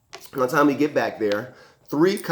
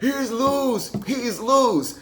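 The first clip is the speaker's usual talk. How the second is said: much higher, imitated panicked cry; alarmed mom; 13 semitones higher, 3 dB louder, much slower than usual. Mom warning everyone as she runs past, alarmed. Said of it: "She's full sprint".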